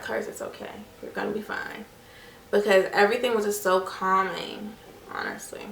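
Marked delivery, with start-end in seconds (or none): 0.0-1.8 hushed, breathy reassurance from God; God's reassurance. Retold or imitated. God softly telling a scared mother she'll be fine. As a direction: murmur it like a calming voice from above. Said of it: "God was really with me and just kinda like".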